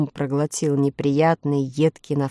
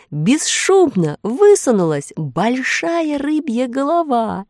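higher, louder storytelling, swelling with suspense; excited narration; 7 semitones higher, 5 dB louder than usual. A narrator's reading. Narrator revealing the fish to listeners, excited suspense. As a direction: build the sudden discovery, then stretch the reveal. Sudden discovery told with rising suspense.

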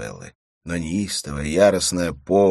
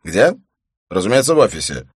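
loud bluster; 5 semitones higher, 10 dB louder than usual. Acted. Husband covering up on phone.

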